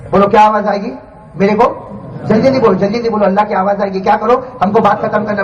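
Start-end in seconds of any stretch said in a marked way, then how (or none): none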